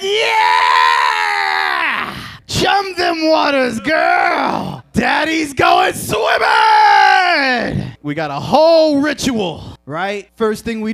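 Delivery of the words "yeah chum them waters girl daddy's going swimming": loud, slow whooping battle cry; hyped yell. Retold or imitated. Comic answering his girlfriend, loud hyped whooping. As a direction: roar it like a pirate off to sea. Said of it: "And I'd be like".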